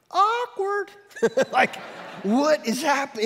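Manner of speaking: high mocking "Awkward"; sing-song awkward. Guests squirming at the party, high sing-song mock. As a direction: draw it out high like a teasing kid.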